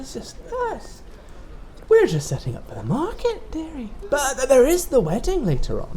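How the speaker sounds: put-on high, sly old shopkeeper voice; sweet but sinister seller; sly mysterious shopkeeper. Toy seller replies.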